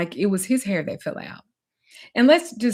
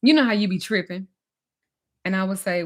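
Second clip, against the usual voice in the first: ordinary talk, nothing marked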